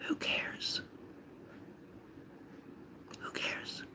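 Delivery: barely audible whisper; whispered aside. Acted. Card reader answering the show-off's boast, whispered. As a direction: whisper it slowly as a dismissive aside.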